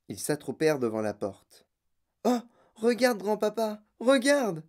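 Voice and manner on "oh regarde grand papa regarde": put-on high-pitched child calling out; excited cry. A narrator's reading. Charlie to grandpa at the window, excited cry. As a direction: cry out in a higher voice. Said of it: "cria Charlie".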